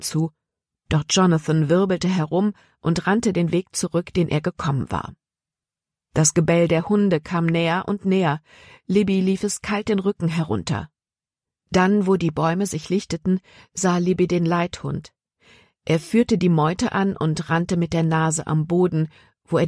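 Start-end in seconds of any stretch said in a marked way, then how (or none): none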